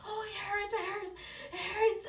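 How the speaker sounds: high pained crying out; pained whimper. Retold or imitated. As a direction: cry out that it hurts. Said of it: "I was like".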